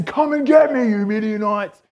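loud, high defiant call; mock battle challenge. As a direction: call out a hammed-up dare to the enemy. Acting out imagined brave challenge to enemy.

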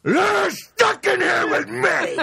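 slow, high, hammed-up yell; mock menacing shout. Podcaster re-enacting Watchmen prison punchline, drawn-out mock roar. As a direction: stretch out the threat at full shout. Repeats the shouted movie threat.

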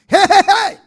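very loud, high-pitched warning roar; roaring back. Shown as a demonstration. Preacher shows believers how to answer the lion, roaring. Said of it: "you have to roar back".

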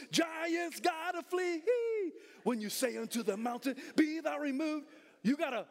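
high, drawn-out preacher's shout; full-force preaching. Real, not acted. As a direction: preach it to the rafters, stretching the phrases.

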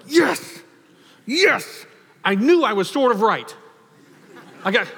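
high, loud vindicated cheer; gleeful Peter. Retold or imitated. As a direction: Peter gloating that he was right. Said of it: "Peter ... going like".

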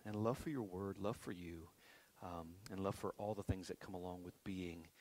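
prayer. Acted. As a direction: pray quietly and earnestly. Prays before sermon.

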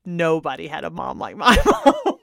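a laugh breaking into the last word; grinning boast that cracks into a laugh. Real, not acted. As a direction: laugh through the claim. Her own claim, laughing through it.

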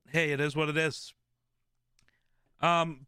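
ordinary talk

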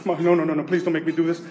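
low, hushed mock protest; acted little-brother panic, kept down; panicked pleading. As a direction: beg quietly not to be made to do it. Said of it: "my brother panicked".

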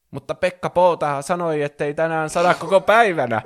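high, loud objection; protesting. A narrator's reading. Sister objecting to going inside because of the forecast, loud high protest. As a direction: protest like an indignant kid. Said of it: "Koko protestoi".

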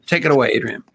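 ordinary talk, nothing marked

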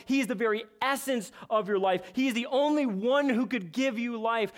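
normal talking voice

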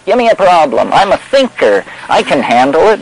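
cocky confidence. Acted. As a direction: act out an eager problem-solver. Voices a confident clear thinker.

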